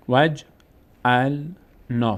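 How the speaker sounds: each syllable drawn out, pause between; slow syllable-by-syllable reading. Shown as a demonstration. Teacher models exercise words for students, slow syllable-by-syllable reading. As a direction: sound it out slowly for learners to repeat.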